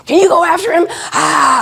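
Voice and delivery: high, loud, frazzled plea; frantic teacher. Retold or imitated. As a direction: beg for help in a panic. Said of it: "this teacher was pretty upregulated".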